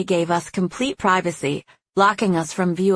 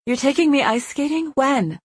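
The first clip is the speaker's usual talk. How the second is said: higher, surprised questioning; 8 semitones higher than usual. A narrator's reading. Woman learns of the skating date, surprised. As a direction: ask it in amazement, pitched up.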